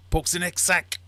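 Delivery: high, loud acted command; soldier's order. German ordering the next sack poked, high and loud.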